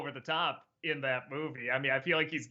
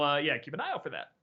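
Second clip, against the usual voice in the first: ordinary delivery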